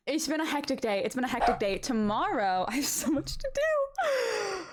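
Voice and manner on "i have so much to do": high, drawn-out mock wail; half-crying, put-on despair; overwhelmed wail. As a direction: whine it slowly, comically overwhelmed. Overwhelmed by moving chores.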